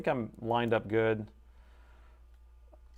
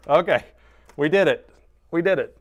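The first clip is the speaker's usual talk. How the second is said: loud victorious cheer; triumphant; 5 semitones higher, 12 dB louder than usual. Real, not acted. Streamer celebrating success with chat, loud. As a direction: celebrate the win.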